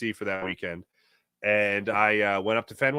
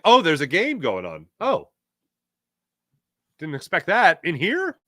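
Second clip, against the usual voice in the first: mock surprise; 5 semitones higher, 5 dB louder than usual. Acting out finding game in mall.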